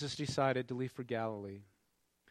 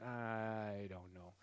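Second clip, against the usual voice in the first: slow, hesitant, noncommittal mumble, softer; doubtful shrug; 5 semitones lower, 8 dB quieter, much slower than usual. Retold or imitated. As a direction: drag it out like an unconvinced friend. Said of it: "and they're saying".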